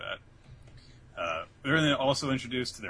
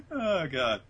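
usual speaking voice

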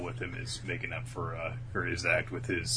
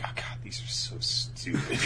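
whispered aside; 2 semitones lower, 8 dB quieter than usual. Retold or imitated. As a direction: imitate a whispered complaint. Girls privately mocking the robes.

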